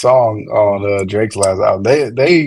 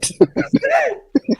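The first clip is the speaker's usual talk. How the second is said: high squealing laugh. Real, not acted. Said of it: "Did you just bang on me?".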